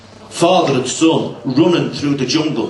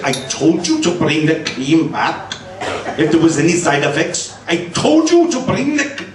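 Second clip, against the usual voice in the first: mock Pakistani accent. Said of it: "Pakistani specialists".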